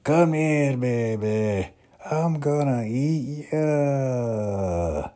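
loud, high, slow put-on Scottish accent; hungry Scottish ogre drawling the threat; Fat Bastard voice. Fat Bastard threatening to eat Mini-Me, loud high Scottish voice. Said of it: "that's the Scottish accent".

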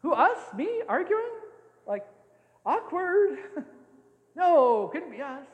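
high, sheepish mock denial; mock innocent disciples. Disciples caught out, playing innocent before Jesus, squeaky mock denial. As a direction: play the guilty innocent, squeaky and dodging. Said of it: "kind of going".

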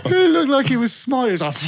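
high, laughing words breaking up. Real, not acted. Describing the happy horse while laughing, giggling. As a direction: laugh through the line.

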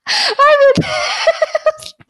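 breathless, high giggles between words; after laughing hard. Real, not acted. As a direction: still laughing, catching her breath. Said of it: "Deixa eu respirar um pouco aqui".

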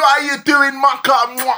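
loud, high mock greeting with a kiss; over-familiar greeter. Podcaster mimicking relatives who come up to you, gushing. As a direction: shout it like an over-affectionate greeter.

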